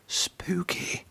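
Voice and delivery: breathy whisper of Spooky; hushed ghostly whisper. A narrator's reading. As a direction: whisper it like a shiver down the spine. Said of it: "said the other engines".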